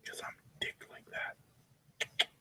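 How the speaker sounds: breathless slow whisper; slow whispered boast; whispered aside. Real, not acted. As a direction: whisper it like a secret. Aside after brag.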